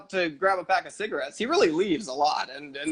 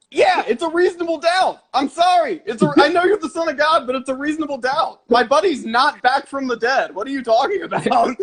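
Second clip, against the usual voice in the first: high, raised voice arguing back; worked-up, hammed-up self-defence, pitched up; indignant protest; 7 semitones higher, 4 dB louder than usual. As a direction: plead your case in exasperated mock outrage. Acts Thomas defending his doubt.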